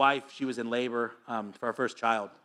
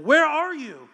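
high, loud calling voice; God calls out to the hiding man, high and loud; 14 semitones higher, 7 dB louder, slower than usual. Acted. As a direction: call out across the garden. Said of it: "called to the man".

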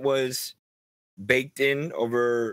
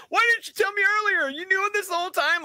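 much higher, squeaky parody of an indignant friend; mock whiny Harry. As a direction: squeal it like a betrayed best friend. Mocking Harry's reaction to butler.

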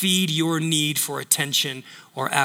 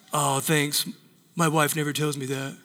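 low, soft, bashful reply; flattered husband; 5 semitones lower, 6 dB quieter than usual. Acted. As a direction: take the praise quietly, down low.

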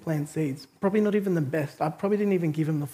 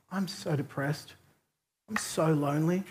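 slow, soft, imitated sadness; retold dejected confession; 7 dB quieter than usual. Weeping stranger opens up after a hug, softly dejected. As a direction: confess it quietly and heavily, acting downcast. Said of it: "he breaks down".

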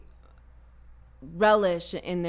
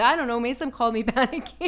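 laughing retelling; 5 semitones higher, 6 dB louder than usual. Retold or imitated. Brother's retold reply, laughing.

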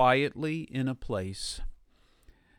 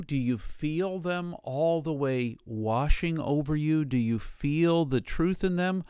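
usual speaking voice

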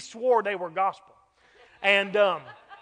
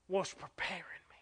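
hushed testimony; 5 semitones lower, 16 dB quieter, faster than usual. Retold or imitated. Preacher retelling Mister James's tearful testimony, hushed and whispered. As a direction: voice drops away to a whisper.

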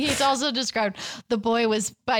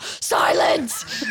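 yelled; 4 semitones higher than usual. A narrator's reading.

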